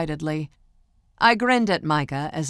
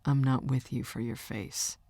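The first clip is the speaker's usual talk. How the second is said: low, soft, matter-of-fact reply; 4 semitones lower, 6 dB quieter than usual. A narrator's reading. Boyfriend reassuring his girlfriend, matter-of-fact. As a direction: state it simply and quietly. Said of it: "in a matter-of-fact tone".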